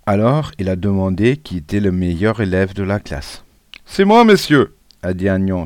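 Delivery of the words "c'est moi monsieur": high, loud, proud little pupil voice. A narrator's reading. Pupil naming himself best of the class to the supervisor, proud. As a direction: pipe up eagerly, full of pride. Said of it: "tout fier".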